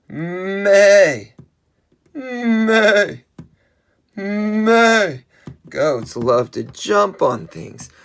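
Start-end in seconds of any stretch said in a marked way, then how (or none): 0.1-5.2 loud, high, drawn-out meh; goat bleat. Pretend. Answering what a goat says, loud high bleating. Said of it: "What does a goat say?".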